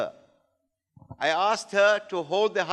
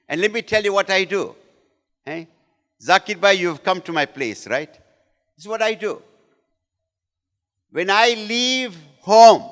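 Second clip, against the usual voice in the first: ordinary talk, nothing marked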